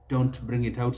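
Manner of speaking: ordinary delivery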